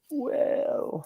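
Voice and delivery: high, soft, drawn-out wavering; tempted hedging. Acted. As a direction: stretch it out high, as if weighing it up. Acts child tempted by forbidden liquor.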